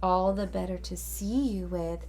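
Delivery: wolf's low put-on granny voice; lightly voiced old grandma; grandmother voice. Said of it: "in his best grandmother voice".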